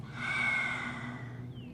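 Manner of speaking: breathy, tongue-out roaring exhale; lion's breath exhale. Shown as a demonstration. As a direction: push the air out with a long open-mouth sigh. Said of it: "Lion's breath".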